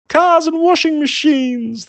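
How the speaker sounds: high, loud cow shout. A narrator's reading. Flying cow shouting nonsense while flapping. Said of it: "the cow shouted".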